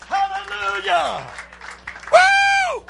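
very high ecstatic cry ending in a whoop; the preacher's final joyful whoop; ecstatic shout. Real, not acted. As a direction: let it burst out at the top of your voice. Climax of the sermon.

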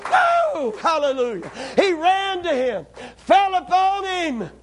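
rising, ringing pulpit cries building to shouts; full-force preaching. Acted. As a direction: preach it to the rafters, driving each line home.

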